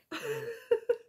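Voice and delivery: high-pitched giggle; short burst of high, relieved laughter. Real, not acted. Laughing off the emotion.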